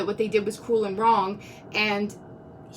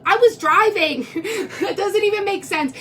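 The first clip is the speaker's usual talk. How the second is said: incredulous; 9 semitones higher, 5 dB louder than usual. Real, not acted. Incredulous at being thought kidnapped.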